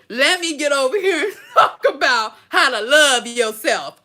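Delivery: laughing mockery. Mocking quitters, laughing.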